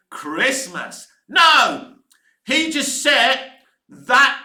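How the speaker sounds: ordinary talk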